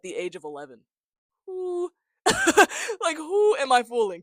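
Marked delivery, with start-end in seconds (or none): 1.5-4.2 much higher, louder disbelief with a laugh; high-pitched self-mocking disbelief; incredulous. Real, not acted. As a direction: laugh at your own lie. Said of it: "mocking himself".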